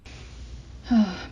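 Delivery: long tired sigh; weary sigh. Acted. Mindy sighing alone over the dreaded account, weary sigh. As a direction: let the breath drop out, weary.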